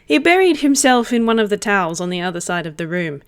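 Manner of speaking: ordinary talk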